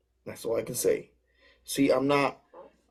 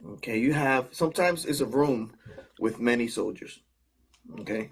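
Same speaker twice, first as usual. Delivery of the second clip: ordinary talk, nothing marked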